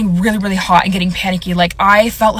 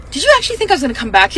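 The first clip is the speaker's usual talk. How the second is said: higher, fast incredulous question; incredulous retort; 5 semitones higher, much faster than usual. Retold or imitated. Client answering the salon in her head, incredulous. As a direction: fire it off in mock disbelief.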